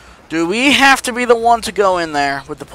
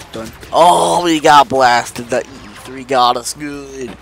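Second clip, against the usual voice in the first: normal talking voice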